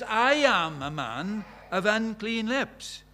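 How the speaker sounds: slow, with weight on "I"; slow emphatic confession. Acted. Isaiah before God's holiness, stressing "I" slowly. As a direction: lean hard on "I", drawn out. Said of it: "He says".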